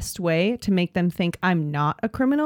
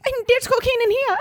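very high-pitched little dog voice; tiny greyhound voice. Acted. Co-host voices a tiny greyhound in the airport, squeaky dog voice. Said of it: "tiny Italian Greyhounds running around an airport being like".

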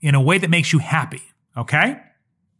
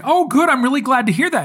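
relieved; 9 semitones higher than usual. Retold or imitated. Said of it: "And I was like".

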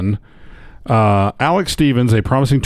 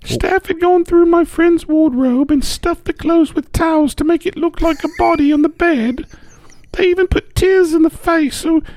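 high woman voice. Retold or imitated. Reading Karen's quote in voice.

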